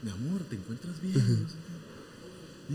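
wife checking on her husband, quietly. Retold or imitated. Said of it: "La esposa le dice". (esposa preocupada, muy suave)

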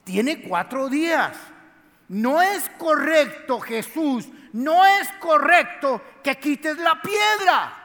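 slow, rising from firm to a high loud shout; building protest. Acted. Marta arguing with Jesus.

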